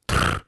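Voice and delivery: low rolled trill of a bug; short, sure, low 'trrr'; confident bug trill. A narrator's reading. Bug answering the boy, confident low trill. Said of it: "ответила она уверенно".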